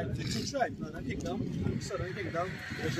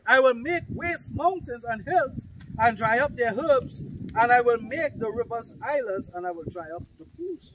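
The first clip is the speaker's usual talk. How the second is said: loud, slow, raised-pitch declaiming; scripture proclamation; 5 semitones higher, 11 dB louder, slower than usual. Acted. Preacher voicing the Lord ready to destroy, thundering proclamation. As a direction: hammer each phrase out with force.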